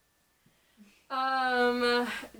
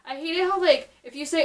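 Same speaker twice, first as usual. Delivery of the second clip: ordinary talk, nothing marked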